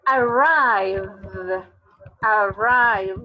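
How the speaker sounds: "arrive" said loud, ending on a buzzing v; model English word. Shown as a demonstration. Teacher showing students the voiced ending of "arrive", loud and clear. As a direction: stretch the final v of "arrive" so it vibrates. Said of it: "termina en un sonido v".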